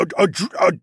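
stuttering. A narrator's reading. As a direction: stutter on the first sounds, unable to get the word out. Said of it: "he stuttered".

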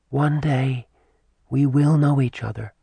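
gentle. A narrator's reading. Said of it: "had compassion and gentle eyes".

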